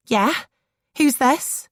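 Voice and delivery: curt Scouse reply; short, wary Scouse voice; Scouse accent. A narrator's reading. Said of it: "Tina's unmistakable Scouse accent".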